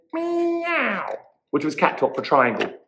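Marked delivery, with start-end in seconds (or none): 0.1-1.1 high meow, falling at the end; little cat. A narrator's reading. Cat answers after thinking, high meow. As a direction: mew long and high, then drop. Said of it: "and thought and said".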